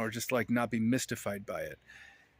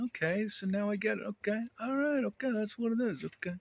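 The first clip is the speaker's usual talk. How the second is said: much higher, excited voice of someone who finally gets it; high giddy okays of sudden understanding; delighted understanding; 10 semitones higher than usual. Retold or imitated. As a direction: squeal it out as the penny drops. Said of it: "so that you can go".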